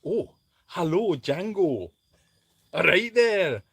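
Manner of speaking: loud, lilting Scottish greeting; hammed-up Scottish hello; Scottish accent. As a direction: put on a big, playful Scottish accent. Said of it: "Can you do a Scottish accent".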